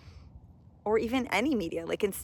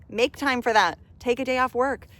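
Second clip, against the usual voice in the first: firm push; 4 semitones higher, 6 dB louder than usual. Real, not acted. Pushing back on excuse.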